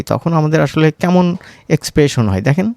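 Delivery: ordinary talk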